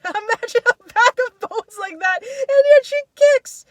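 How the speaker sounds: high, loud, incredulous hooting; mocking shout, far above normal pitch; laughing old man. A narrator's reading. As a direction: cry it out through laughter, drawing out the end. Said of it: "breaks out laughing".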